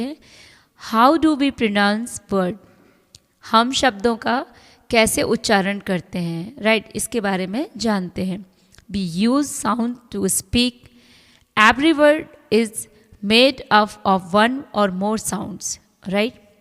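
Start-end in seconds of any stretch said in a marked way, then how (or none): 0.9-2.6 reading the rule aloud very slowly. Acted. Reads English heading slowly. 8.9-15.8 reading the rule aloud very slowly. Acted. Reads English text slowly.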